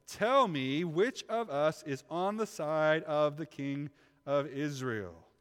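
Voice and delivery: loud, slow, weighty demand. A narrator's reading. King interrogating his officers, loud and demanding. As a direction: press each word hard, drawn out. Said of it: "he demanded them".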